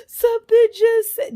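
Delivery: high, loud mock wail; imitating hysterical crying. Teen telling stepdad what happened, mock hysterical crying. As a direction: blurt it out through hammed-up sobs. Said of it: "hysterically crying".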